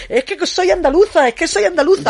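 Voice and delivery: radio guest mimicking the minister's Andalusian excuse, high mocking Andalusian accent.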